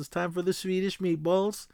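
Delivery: high, lightly Swedish "Swedish meatballs"; put-on Swedish accent. Ophelia in disguise cueing the others, light Swedish accent. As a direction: announce it sweetly as the fake Swede. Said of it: "Ophelia gets up and goes".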